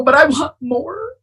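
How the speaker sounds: put-on whiny, demanding like a sulky kid; mild petulant whine to her husband; petulant child. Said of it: "I sound like a petulant child".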